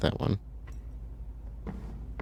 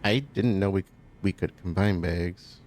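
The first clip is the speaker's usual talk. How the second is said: ordinary talk, nothing marked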